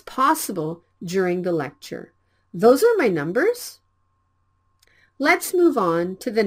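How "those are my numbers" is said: "Those are my numbers?" said loud, rising at the end; loud question rise. Shown as a demonstration. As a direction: call it out as a question for students to repeat. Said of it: "speak the sentences as many times".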